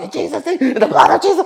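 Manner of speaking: very high, strained mock yell; hammed-up panicked praying; mock shouting match. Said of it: "It was literally a shouting match".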